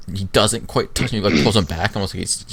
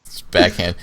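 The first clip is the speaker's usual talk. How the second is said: normal talking voice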